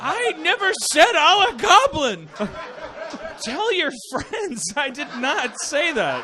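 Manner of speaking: high, loud, slow laughing denial; laughing indignant protest. Real, not acted. Comedian denying a misheard word to the podcast audience, loud laughing protest. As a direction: protest through laughter that you never said it.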